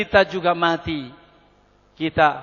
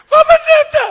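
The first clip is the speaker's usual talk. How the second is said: mock-hysterical high wailing shout; hysterical crying; 15 dB louder than usual. Distraught wife crying out to the pastor, hysterical wail. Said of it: "Wih, histeris lagi".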